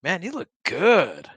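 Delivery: slow, high bro voice; bro compliment. Retold or imitated. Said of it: "just be like".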